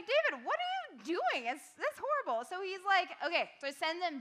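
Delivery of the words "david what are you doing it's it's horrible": high, loud pleading exasperation; mock scolding David. Youth leader scolding King David, high exasperated outcry. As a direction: cry out at David like a fed-up friend. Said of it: "And it's like".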